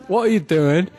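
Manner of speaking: 이스트엔드 억양; East End accent. Pretend. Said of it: "Hitler as an East Ender".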